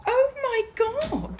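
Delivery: high, very slow amazement; drawn-out disbelief; amazed gasp. Real, not acted. Amazed at calm dog.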